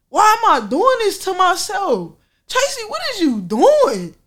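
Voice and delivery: high-pitched, incredulous self-talk; mock astonishment at yourself; self-scolding realization. Acts out talking to herself.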